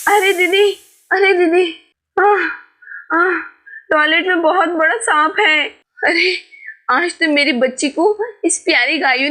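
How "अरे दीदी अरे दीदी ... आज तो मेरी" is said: frightened scream. Acted. Mother screams to Sita about the snake, high and frightened. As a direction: shriek at the sight of a snake. Sees a big snake in toilet.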